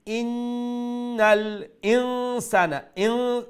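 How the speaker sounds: ইন্নাল ইনসানা high, firm initial i, long hummed n; firm hamza. Shown as a demonstration. Teacher showing hamza, ইন্নাল ইনসানা firm with sustained n. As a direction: start each ই of ইন্নাল ইনসানা firmly, hold the n. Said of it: "দৃঢ়ভাবে সেটাকে উচ্চারণ করতে হবে".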